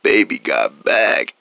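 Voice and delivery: slow, deep drawn-out voice; very low voice. Acted. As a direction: show off a suddenly deep, low voice. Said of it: "Why is it so low?".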